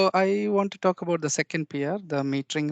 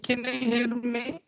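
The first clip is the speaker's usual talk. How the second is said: tin can phone; 7 semitones higher than usual. Real, not acted. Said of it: "sound like a tin".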